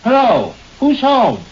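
high, searching call from the door. Acted. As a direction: call out to see who's home. Calling from the front door.

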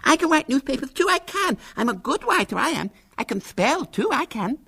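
put-on very high plaintive lisping girl; shrill six-year-old insisting; Violet Elizabeth Bott. A narrator's reading. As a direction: pile up boasts in a pleading little squeak. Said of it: "she said plaintively".

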